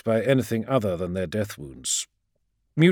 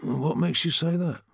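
high, questioning, eyebrow raised; skeptical; 8 semitones higher than usual. A narrator's reading. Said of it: "the raised eyebrow in Cybele's reply".

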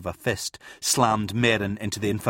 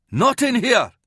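high, loud roar; 13 semitones higher, 6 dB louder than usual. Acted. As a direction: bellow to stop the fight. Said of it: "Kurth roared".

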